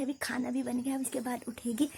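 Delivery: speaking softly. Real, not acted. Mother talking quietly while her daughter sleeps, hushed. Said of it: "तभी मैं आराम आराम से बोल रही हूँ".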